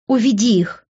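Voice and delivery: high-pitched woman's order; angry demand. A narrator's reading. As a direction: demand they be removed at once. She demands they be taken away.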